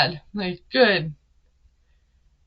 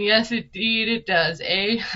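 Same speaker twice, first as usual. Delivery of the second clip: mock Canadian voice; hammed-up Canadian; Canadian accent. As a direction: put on a playful Canadian lilt. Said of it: "It sounds Canadian".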